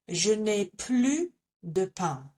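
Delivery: plus said plu, S dropped, slowly; French plus, silent S; plus without the S. Shown as a demonstration. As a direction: say je n'ai plu de pain, no S. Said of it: "we do not pronounce the S".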